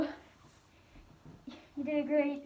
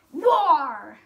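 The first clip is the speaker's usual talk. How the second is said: shout; 11 dB louder than usual. Acted. Declaring war.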